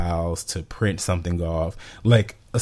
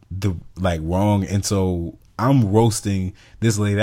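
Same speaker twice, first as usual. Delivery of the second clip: ordinary delivery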